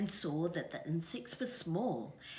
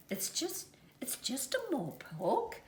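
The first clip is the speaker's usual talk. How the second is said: reassuring whisper; 9 semitones higher than usual. A narrator's reading. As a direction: hush the chick, it's fine. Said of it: "assured Mother Kiwi".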